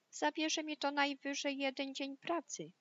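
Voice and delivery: high, light piggy voice; lazy little pig. Acted. Lazy pig tells the others it's a day's work, high piggy voice. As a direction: pitch it up for a carefree little pig.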